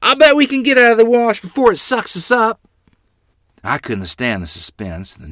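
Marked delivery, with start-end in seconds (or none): 0.0-2.6 brave shout, much higher and louder; hollering. A narrator's reading. Child hollering to frightened sisters during flood. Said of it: "I hollered".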